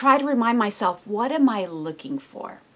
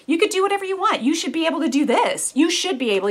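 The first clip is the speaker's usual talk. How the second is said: a higher, pushy tempter's taunt; taunting Satan; 5 semitones higher, faster than usual. Retold or imitated. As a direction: goad him like a tempter.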